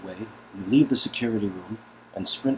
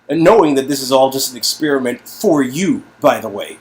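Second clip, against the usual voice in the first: louder, building indignation; incredulous protest; 4 semitones higher, 10 dB louder than usual. Acted. To Nadia about staying, building indignant. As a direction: push back harder each phrase.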